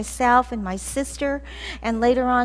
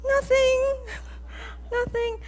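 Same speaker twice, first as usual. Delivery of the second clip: sheepish denial; 13 semitones higher than usual. Retold or imitated. Caught teen denying.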